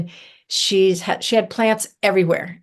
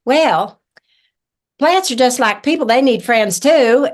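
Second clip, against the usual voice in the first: higher Kentucky granny voice; very Kentucky accent; 5 semitones higher, 5 dB louder than usual. Retold or imitated. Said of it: "in her very Kentucky accent".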